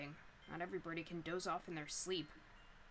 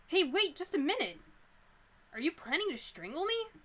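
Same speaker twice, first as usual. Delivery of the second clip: alarmed yelp; 9 semitones higher, 11 dB louder than usual. Acted. Being strangled by a hostage.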